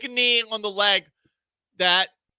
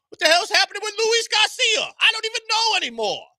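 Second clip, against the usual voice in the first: exasperated shout; 12 semitones higher, 4 dB louder than usual. Real, not acted. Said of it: "exasperated outburst".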